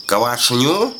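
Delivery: КВАШНЮ drawn upward as a puzzled question; questioning mishearing. A narrator's reading. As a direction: ask back КВАШНЮ, pitch climbing at the end. Old woman mishears the old man. (переспрашивает: «Квашню?», с подъёмом в конце)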